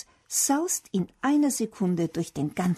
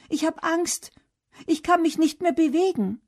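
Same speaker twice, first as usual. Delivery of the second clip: put-on high-pitched, scared child's voice; frightened call; 9 semitones higher, 4 dB louder than usual. A narrator's reading. Felix is stuck high in the tree, frightened call. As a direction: call out high, frozen with fear. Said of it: "ruft Felix".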